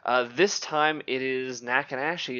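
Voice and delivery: usual speaking voice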